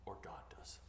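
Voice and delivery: unvoiced whisper; whispered punchline. Preacher ends his point to the church in a whisper. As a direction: drop to a whisper for the last words.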